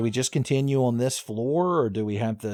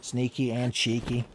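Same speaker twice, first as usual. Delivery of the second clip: ordinary talk, nothing marked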